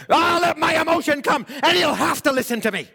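hammed-up emotional shout; mock emotional outburst. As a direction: push it out like a manipulative outburst. Preacher acting emotional pressure.

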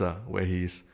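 ordinary talk

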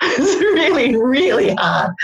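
laughing through really hard; amused laugh spilling into the words. Real, not acted. Guest telling the host about phoning in French, laughing.

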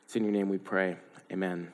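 soft, low, hushed prayer; closing prayer. Real, not acted. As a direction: pray it quietly to close.

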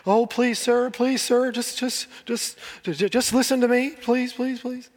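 high, stammering, begging whine; groveling plea. Retold or imitated. Preacher mocks a believer begging God, high groveling whine. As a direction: grovel like a frightened servant before a master. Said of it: "you wouldn't be groveling".

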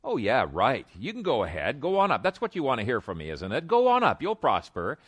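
scoffing fake encouragement, voice leaping up; mocking. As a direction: sneer it like an eye-rolling prophet. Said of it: "he said that with a mocking voice".

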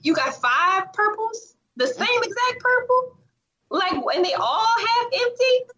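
high-pitched, rising disbelief; incredulous questioning. Retold or imitated. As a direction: question it in a high, puzzled rise. Voicing her disbelief at polish wall.

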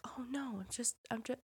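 soft, bashful Thor fending off his axe; a quiet, embarrassed brush-off to his axe; coy denial. Retold or imitated. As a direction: murmur it like a flustered crush. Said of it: "talking to Stormbreaker".

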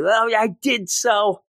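high, petulant mock protest; Ben, mad. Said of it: "He's all mad".

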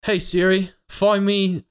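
bad Australian accent drifting toward British; mock Australian accent, addressing a voice assistant; put-on accent. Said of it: "say it with an accent".

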